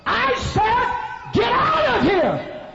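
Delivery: high, drawn-out bellow; angry shouted order. Retold or imitated. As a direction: roar it to clear the room. Angry pastor repeats order to leave.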